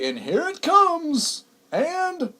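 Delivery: high, slow mock build-up to the big moment; mock suspense. Radio host mocking Y2K panic, high drawn-out suspense. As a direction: stretch it out like a dramatic countdown. Mocking the year 2000 countdown.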